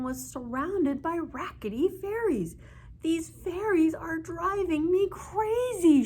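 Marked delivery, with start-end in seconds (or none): 3.0-6.0 put-on high, loud, slow old woman yell. A narrator's reading. Old woman yelling at the rackety fairies. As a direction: yell it out in frustration. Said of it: "she yelled".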